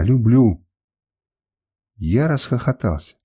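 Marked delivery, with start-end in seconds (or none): none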